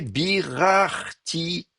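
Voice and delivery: careful slow-motion model word; drawn-out syllables. Shown as a demonstration. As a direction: sound it out syllable by syllable. Models the parallel form.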